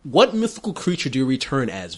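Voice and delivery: ordinary talk, nothing marked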